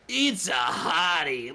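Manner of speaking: slow, badly acted line; bad acting. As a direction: ham it up terribly. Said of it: "he says, like".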